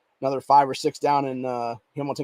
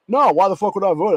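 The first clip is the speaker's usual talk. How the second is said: mock indignant complaint; 5 semitones higher, 5 dB louder than usual. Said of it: "bitch and moan then".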